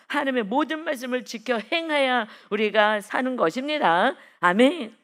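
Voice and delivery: loud, emphatic preacher's declaration; forceful preaching. Acted. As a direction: drive it home and call for an amen. Preaches the command at full force.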